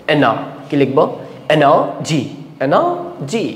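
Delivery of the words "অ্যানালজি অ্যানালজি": Analogy with the NA pushed and drawn out; careful model reading of analogy, leaning on NA; stress on second syllable. Shown as a demonstration. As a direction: split analogy for a learner, pressing on its second syllable. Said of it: "stress second syllable".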